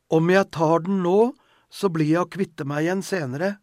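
put-on loud childish whimper of complaint; whining complaint. A narrator's reading. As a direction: whine it like a child refusing medicine. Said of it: "klagde hun, enda mer klynkende".

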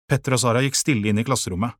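ordinary delivery, nothing marked

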